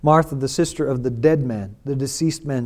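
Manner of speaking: normal talking voice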